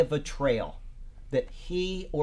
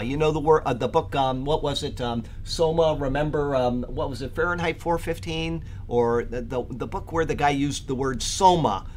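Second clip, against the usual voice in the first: ordinary talk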